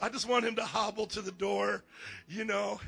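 usual speaking voice

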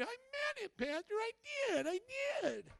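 mock pleading "I meant it"; mock pleading congregant. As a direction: ham up a desperate churchgoer insisting. Mimics congregants again.

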